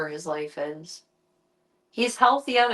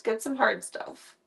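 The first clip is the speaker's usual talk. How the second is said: normal talking voice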